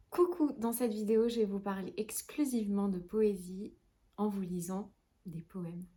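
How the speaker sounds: normal talking voice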